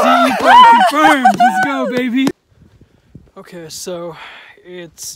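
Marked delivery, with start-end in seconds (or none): 0.0-1.3 much higher, much louder triumphant yell; excited shout. Real, not acted. Repeats confirmation in triumph. 1.4-2.3 much higher, louder cheering; excited shout. Real, not acted. Celebrating sighting.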